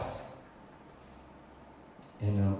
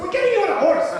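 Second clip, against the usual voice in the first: very high, full-out yell; hysterical, loud final word; screamed insistence; 10 dB louder, much faster than usual. Retold or imitated. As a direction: scream down the objection. Acted argument reaches its peak.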